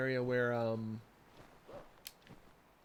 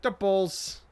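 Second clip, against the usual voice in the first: put-on loud, higher-pitched Chicago accent; mock Chicago accent; 6 semitones higher, 8 dB louder than usual. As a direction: boom it out in hammed-up Chicago accent. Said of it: "doing the Chicago accent".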